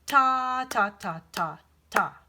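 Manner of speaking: "ta, ta, ta, ta, ta" chanted, starting loud and fading; rhythm syllables. Shown as a demonstration. Teacher chanting the final rhythm, starting loud then fading. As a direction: sound out the last rhythm on "ta". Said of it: "the rhythm is".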